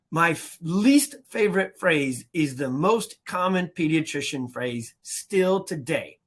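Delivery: ordinary talk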